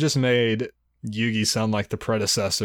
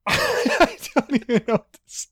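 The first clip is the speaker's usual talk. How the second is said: laughing; 13 semitones higher than usual. Real, not acted. Cracking up at Kirby idea.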